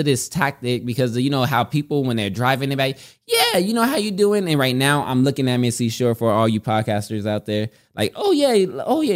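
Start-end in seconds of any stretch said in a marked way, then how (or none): 3.3-4.5 casual chatty driver. Retold or imitated. 8.1-9.1 casual chatty driver. Retold or imitated.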